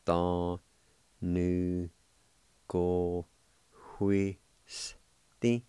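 very slow, drawn-out Cherokee word Atanugohuisti; slow syllable by syllable. Shown as a demonstration. Teacher demonstrating Atanugohuisti to students, very slowly. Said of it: "it's pronounced like this".

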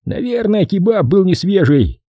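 teasing. Acted. Jason teases the boss.

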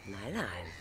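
quiet, calmer afterthought; soft repeat. Acted. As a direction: take it back down quietly. After the outburst, softer.

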